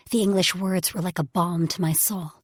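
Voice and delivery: ordinary delivery, nothing marked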